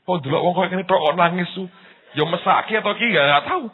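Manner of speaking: high, loud retold exclamation; mock onlooker. Menirukan komentar orang melihat ia menangis. (menirukan orang heran dengan suara tinggi)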